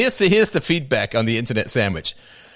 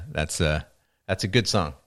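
usual speaking voice

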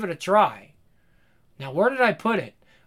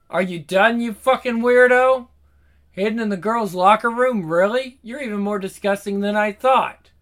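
angry; 5 semitones higher, 7 dB louder than usual. Acted. Girl catches creep in locker.

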